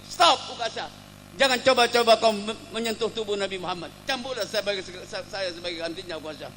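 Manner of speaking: high, loud cry to stop; urgent intervention. Acted. Abu Bakar stops Ukasyah and offers himself, urgently. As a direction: halt him urgently, then plead.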